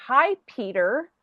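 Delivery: Peter in plain English in place of Pierre; anglicised name. Retold or imitated. Canadian greeting Pierre, turning it into English Peter. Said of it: "or you say".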